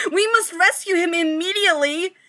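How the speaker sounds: loud, high, mock-heroic call to action; rally the team like a cartoon hero; heroic rallying cry. Friends declaring a rescue, mock-heroic and loud. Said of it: "They're all like".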